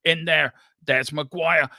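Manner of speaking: normal talking voice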